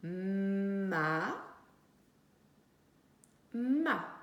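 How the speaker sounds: "ma" twice, each with a long hummed m; syllable sounded out. Shown as a demonstration. Teacher sounding out the syllable she writes, drawn-out m. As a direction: linger on the m of "ma" before the a. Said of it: "pour écrire la syllabe ma".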